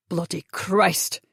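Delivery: low, slow, rough snarl of disgust. Acted. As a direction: spit it out in shocked revulsion. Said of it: "he snarled".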